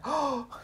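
short high sucked-in breath; demonstrated inward gasp. Shown as a demonstration. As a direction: try voicing while breathing in. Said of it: "息吸いながら喋る方法って".

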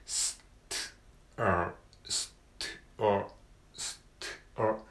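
"ス" and "ト" said as bare clipped consonant sounds; split consonants. Shown as a demonstration. Teacher isolating each consonant of the cluster. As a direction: break the cluster into single consonants. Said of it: "子音が三つ連続で続きます".

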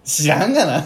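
loud, mock-exasperated retort. Real, not acted. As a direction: toss it back like who knows. Teasing retort to obscure word.

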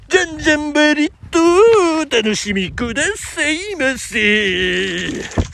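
high singsong hype over the PA; pachinko hall announcement. Shown as a demonstration. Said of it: "ホールアナウンス".

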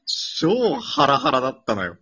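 loud, slowed-down excited telling. Real, not acted. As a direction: stress how nerve-racking it was.

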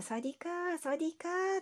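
put-on light bright "サワディーカー" like the shop girls; mimicking the Thai shop girls greeting. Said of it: "女の子たちがみんな".

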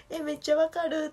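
high, bright burst of recognition; excited agreement. Retold or imitated. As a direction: blurt out how much you relate. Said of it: "って思って、".

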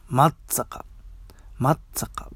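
松坂 said as "Mattsaka", pausing on the doubled ts; local pronunciation. Shown as a demonstration. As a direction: demonstrate 松坂 slowly as hometown "Mattsaka". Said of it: "僕らの地元ではね".

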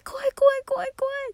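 high, loud frightened squeal; spooked friend. Retold or imitated. Friend on the call hearing the baby talk, scared high squeal. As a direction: squeal it like you're truly creeped out.

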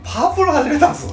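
punchline delivery. Acted. Repeated as punchline.

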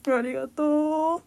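drawn-out, grand thank-you; milk the thanks; slow hammy thank-you. Pretend. Mock gratitude.

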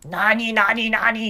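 high, loud flustered boss; flustered shop owner. Retold or imitated. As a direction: blurt it out, caught off guard. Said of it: "恥ずかしかったんでしょうね". (照れて慌てる大将の高い声)

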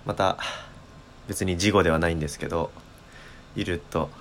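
ordinary talk

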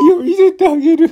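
put-on very high, loud drunk woman; dead drunk woman. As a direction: shriek it, wasted and pushy. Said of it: "一人の泥酔した女性が".

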